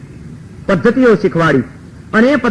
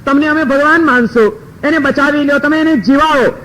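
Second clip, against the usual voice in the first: higher pitched urgent begging; pleading family; 6 semitones higher than usual. A narrator's reading. Family begging Narad to save the son, high pleading. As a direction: plead with the sage, voice raised high.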